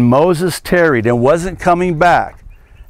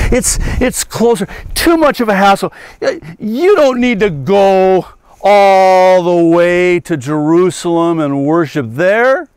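hammed-up salesman; 6 semitones higher than usual. Preacher mocking Jeroboam as a hammy pitchman. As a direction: sell the altar like a huckster.

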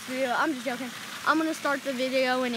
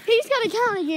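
excited shout; 7 semitones higher, 6 dB louder than usual. Real, not acted. Repeating demand to recount.

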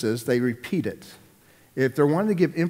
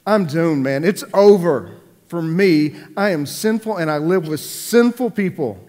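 loud anguished cry, pitch pushed up; despairing Isaiah; 4 semitones higher, 8 dB louder than usual. Retold or imitated. Isaiah on the floor before God, crying out loud in despair. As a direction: wail it out as a man undone. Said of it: "he finds himself on the floor going".

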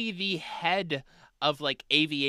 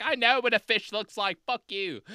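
high-pitched testy retort, hammed up; mock angry; 8 semitones higher than usual. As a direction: play an indignant witness snapping back. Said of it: "he got so mad".

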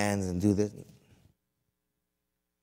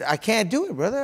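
high, louder exasperation; mad retold protest; 11 semitones higher, 6 dB louder than usual. Trainee admitting defeat to his teacher, mad. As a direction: act out giving up in frustration. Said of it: "And I was mad".

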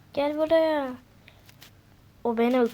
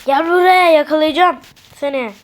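louder mock-menacing call; chase shout; 4 semitones higher, 8 dB louder than usual. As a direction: bellow it while chasing. Chasing a fleeing character. (kaçan Bulut'un peşinden bağırarak)

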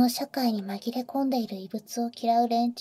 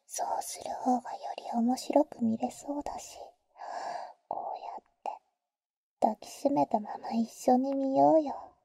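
teasing whisper; 4 dB quieter than usual. Acted. Older sister teasing the boy in his ear, whisper.

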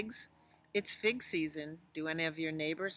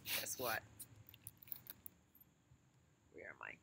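soft playful whisper; 12 semitones lower, 10 dB quieter than usual. Real, not acted. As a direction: lean in and whisper. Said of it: "unvoiced whisper".

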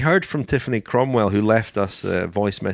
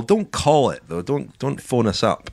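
ordinary talk, nothing marked

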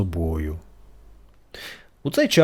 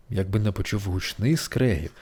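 plain reading voice

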